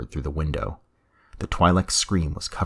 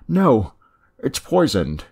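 sudden high, loud outburst of fear; panicked blurt; 9 semitones higher, 8 dB louder, slower than usual. Acted. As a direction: blurt it out in terror. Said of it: "he blurted".

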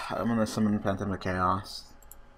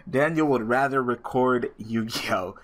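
ordinary talk, nothing marked

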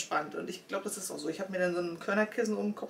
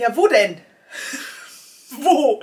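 loud frustrated where; exasperated shout; 6 semitones higher, 10 dB louder than usual. Retold or imitated. Searching for the phone number, loud exasperated cry. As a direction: shout it out in disbelief. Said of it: "Ich denk".

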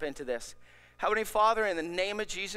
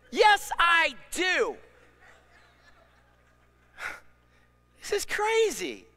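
panicked protest; 6 semitones higher, 4 dB louder, slower than usual. Acted. Anxious man argues back.

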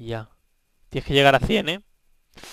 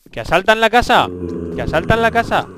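high, loud shouts of alarm; alarm cry; 8 semitones higher, 11 dB louder than usual. Real, not acted. Gamer warning of house raid, high alarmed shout.

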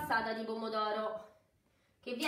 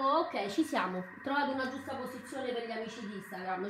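ordinary delivery

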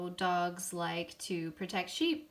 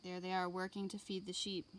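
ordinary talk

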